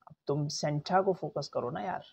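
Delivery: ordinary talk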